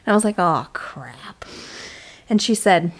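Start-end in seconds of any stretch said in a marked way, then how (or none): 0.4-1.4 lower, hushed whisper of dismay; whispered oh crap. Retold or imitated. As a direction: breathe it out as if caught. Caught not reading the book.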